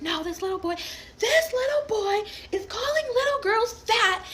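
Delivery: very high, loud mock outrage; imitating pissed woman. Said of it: "but she's still pissed".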